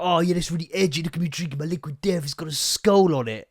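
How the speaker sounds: fast smug imitation of a trendy drinker; lightly voiced poser showing off; hint of a smug edgy kid; mock edgy drinker. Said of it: "I don't know if you're thinking".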